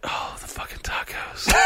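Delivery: breathy whisper under the breath; hushed panic about the tacos. Retold or imitated. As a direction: whisper it so nobody hears during meditation. Frantic inner thought during meditation.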